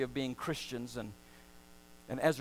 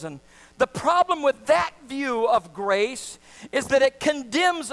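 rising, louder preacher's cadence; preaching; 7 semitones higher, 8 dB louder, slower than usual. Acted. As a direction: preach it with full conviction. Preacher building to a climax.

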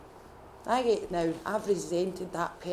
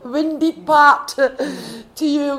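words squeezed out through a real laugh, higher and louder; 8 semitones higher, 14 dB louder, much slower than usual. Real, not acted. Laughing through confession to God.

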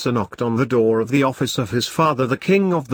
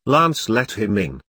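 plain reading voice

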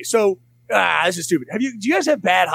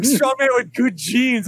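higher, slow, teasing echo; higher slow teasing repeat of the boast; mock strongman quote; 7 semitones higher, slower than usual. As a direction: savour their brag to mock it.

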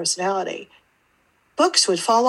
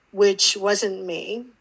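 normal talking voice

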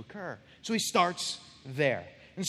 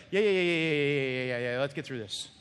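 bored, lowered "yeah, yeah" run; dismissive child; 4 semitones lower than usual. Acted. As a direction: brush a parent off impatiently. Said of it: "having this kind of attitude".

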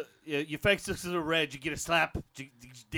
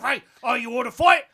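shouted, much higher Aussie fight call; loud Aussie challenge; 9 semitones higher, 13 dB louder than usual. Acted. Fake Aussie to the Englishman, shouting for a fight. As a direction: bellow it like a rowdy bloke.

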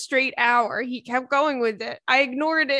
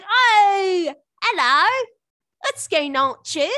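loud, high fake British voice; hammed-up British greeting, slow ending; mock British accent; 8 semitones higher, 7 dB louder, slower than usual.

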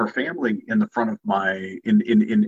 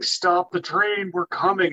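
retold shouting; 8 semitones higher, slower than usual.

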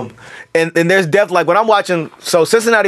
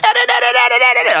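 imitating screaming raps; 11 semitones higher, faster than usual. Rapper mocking own old records, high mock scream-rap. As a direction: mimic your younger rap voice in high, nonsense syllables. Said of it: "the screaming raps".

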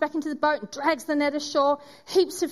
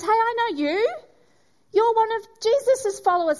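high, pointed accusation, a bit louder; accusing bystander; 9 semitones higher, 4 dB louder than usual. Acted. Bystander at the fire accusing Peter, high and pointed. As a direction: call him out as a stranger by the fire.

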